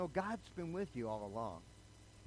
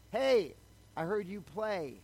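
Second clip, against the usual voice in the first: loud, much higher call across the lot; 6 semitones higher, 7 dB louder, slower than usual. Retold or imitated. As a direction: hail him from the car window. Guy calling from his car.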